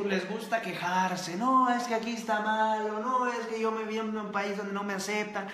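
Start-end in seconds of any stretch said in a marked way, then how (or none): 1.4-5.5 a lightly voiced whiny complainer, a bit higher; mock the excuses in a higher, whinier voice. Host mocking young viewers' excuses, mild whiny complainer. Mimicking people who complain.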